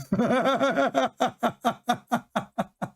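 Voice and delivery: rolling laughter, high; sustained giggling fit; long high laugh. Real, not acted. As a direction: crack up at the absurdity. Laughing at California-Alaska comparison.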